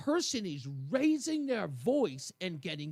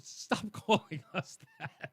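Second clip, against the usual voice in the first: laughing scold; 2 semitones lower, slower than usual. Retold or imitated. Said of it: "And she goes".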